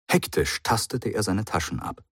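usual speaking voice

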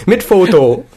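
laughing through words. Real, not acted. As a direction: giggle while speaking. Joking about a perfume photo.

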